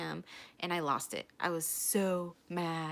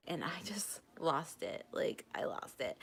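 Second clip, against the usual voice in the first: hushed whisper; 2 semitones lower, 7 dB quieter than usual. Real, not acted. Recalling the moment her ex walked in, in a hushed whisper. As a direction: say it under your breath. Telling of breakdown at ex.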